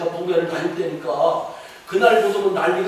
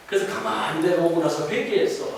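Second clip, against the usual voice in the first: ordinary talk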